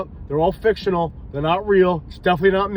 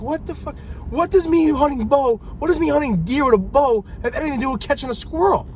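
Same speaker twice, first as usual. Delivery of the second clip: pitched way up in disbelief, what the fuck?; incredulous outburst; 8 semitones higher than usual. Retold or imitated. Cop to partner about bow hunting, high disbelieving outburst. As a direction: squeak out the outrage at a crazy idea. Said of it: "I'm like".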